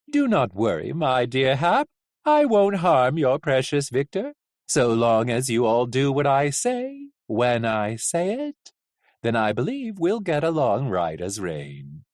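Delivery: smooth, lilting villain; falsely reassuring coachman. Acted. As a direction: reassure him with veiled menace, stretching phrase ends.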